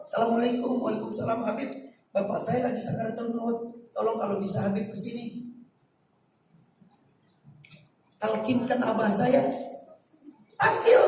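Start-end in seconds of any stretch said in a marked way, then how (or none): 0.1-9.4 quiet phone call. Retold or imitated. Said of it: "Teleponin cepat".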